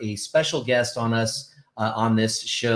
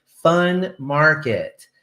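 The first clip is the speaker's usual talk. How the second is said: drawn-out, louder, higher mock enthusiasm; sarcastic excitement; 4 semitones higher, 5 dB louder, much slower than usual. Real, not acted. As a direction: stretch it out with tongue-in-cheek excitement. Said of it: "would you say that it's more sarcastic".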